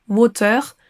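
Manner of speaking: WATER with a French R at the end. Shown as a demonstration. Teacher warning learners off it, French-accented WATER. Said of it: "avec un R à la française".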